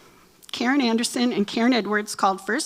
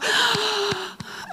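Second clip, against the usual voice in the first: mock gasp; 10 semitones higher than usual. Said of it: "you're gonna go".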